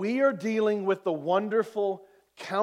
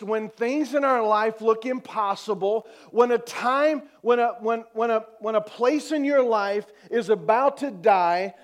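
insistent, raised preacher's voice; forceful preaching; 2 semitones higher, 4 dB louder than usual. Real, not acted. Preacher to congregation about life's bleakest moments, forceful. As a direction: build the phrases in driving repetition.

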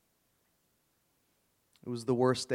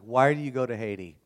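loud, puzzled questioning; mock a crotchety neighbor demanding an explanation; imitated baffled neighbor; 8 dB louder than usual. Crank neighbor questioning the traveling preacher, loudly baffled.

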